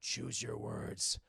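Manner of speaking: very low, slow, rasped Batman threat; deep Batman growl. Retold or imitated.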